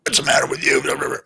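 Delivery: a breathless whisper of the scolding line; whispering the harsh words a dad once barked; whispered mock scolding. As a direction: replay the snap at her in a whisper. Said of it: "I went at her".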